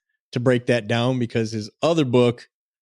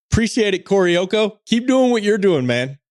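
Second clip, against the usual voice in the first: excited; 9 semitones higher than usual. Real, not acted. Thanking a reviewer on air.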